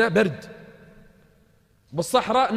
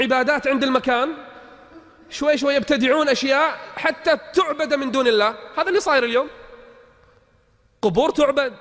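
building from raised voice to a higher, louder shout; rising alarm; 9 semitones higher, 4 dB louder than usual. Real, not acted. Preacher warning the crowd about grave worship, building to a shout. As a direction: climb step by step into an alarmed cry.

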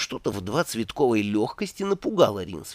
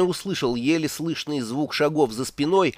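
ordinary delivery, nothing marked

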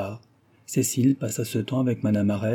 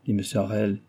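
plain reading voice

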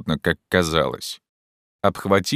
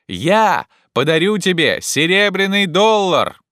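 high, raised, emphatic instructions; calling up; 10 semitones higher, 5 dB louder than usual. Acted. Legrand promises Jupiter a dollar, calling up. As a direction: make every phrase carry upward.

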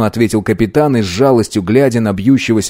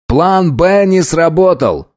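raised, slow and tense; loud report; 8 semitones higher, slower than usual. Acted. Riley telling the crew the plan failed, raised voice. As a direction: announce it firmly. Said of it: "громко сообщил Райли".

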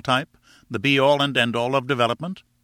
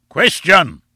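loud, high heckle booming out; booming shout; 5 semitones higher, 9 dB louder than usual. A narrator's reading. Professor heckling the lecturer from the platform, booming. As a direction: boom it across a packed hall. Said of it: "boomed a voice from the platform".

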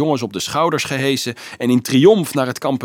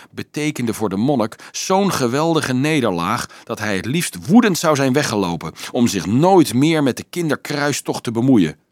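ordinary delivery

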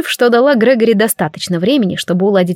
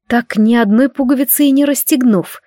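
usual speaking voice

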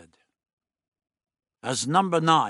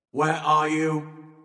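slow, loud, higher monotone; monoid challenge; 5 semitones higher, 5 dB louder, much slower than usual. A narrator's reading. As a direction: call it out on one stretched note.

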